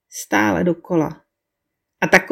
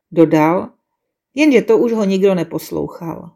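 ordinary delivery

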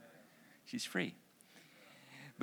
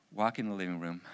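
ordinary talk

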